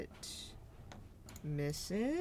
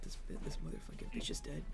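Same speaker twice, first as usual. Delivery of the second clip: muttered; 11 dB quieter, faster than usual. Real, not acted. Muttered curse under breath.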